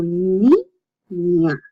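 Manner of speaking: "ni, ña" as two separate syllables with a pause. Shown as a demonstration. Teacher showing the ñ, "ni, ña" broken in two. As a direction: sound out "ni", stop, then "ña". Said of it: "you separate the two".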